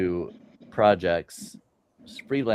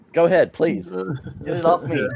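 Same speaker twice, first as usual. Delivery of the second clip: mock pleading; 8 semitones higher, 4 dB louder than usual. Mock plea, higher and louder.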